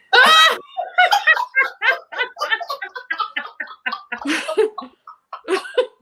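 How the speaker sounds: high, loud shrieking laughter; a long burst of helpless laughter; loud high cackling at her own joke; screaming laugh. Real, not acted.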